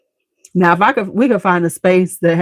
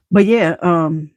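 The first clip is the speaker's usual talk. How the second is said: usual speaking voice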